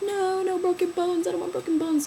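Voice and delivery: high, whiny refusal; mock mad protest. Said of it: "I was all mad".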